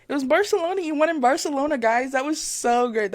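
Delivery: high, loud rush of delight; excited. Real, not acted. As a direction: blurt out the happy discovery. Delighted on finding the right race.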